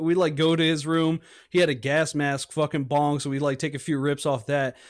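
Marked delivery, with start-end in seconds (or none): none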